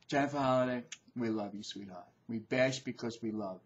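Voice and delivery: soft, low and affectionate; tender reassurance. Real, not acted. Vlogger making peace with the singer, tender and hushed. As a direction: soothe her after the telling-off. Softens after ranting at singer.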